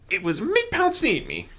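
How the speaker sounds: high dainty mare voice; prim lady answer; Rarity voice. A narrator's reading.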